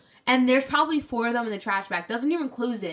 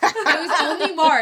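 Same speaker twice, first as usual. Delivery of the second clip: laughing; 5 semitones higher, 6 dB louder, slower than usual. Real, not acted. Laughing callout.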